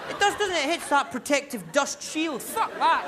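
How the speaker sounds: high, loud comic exasperation; worked-up rant. Acted. Comedian on milk hitting muesli dust, high and worked up. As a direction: cry it out in mock outrage.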